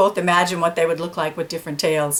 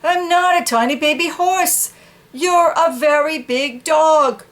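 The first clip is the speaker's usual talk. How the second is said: arguing dog; 8 semitones higher than usual. Acted.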